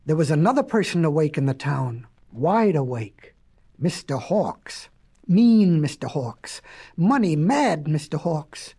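low, deliberate villain introduction; darkly measured storytelling; ominous narration. A narrator's reading.

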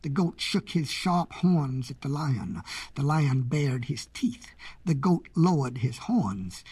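plain reading voice

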